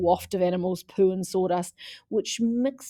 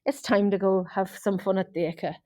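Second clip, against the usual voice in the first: put-on herd the kids along in a Scottish accent; Scottish mum rounding up the boys; thick Scottish accent. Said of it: "in her thick Scottish accent".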